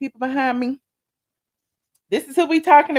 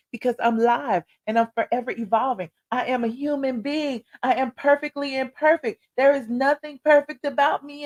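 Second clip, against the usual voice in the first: ordinary talk, nothing marked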